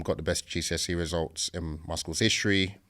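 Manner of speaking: normal talking voice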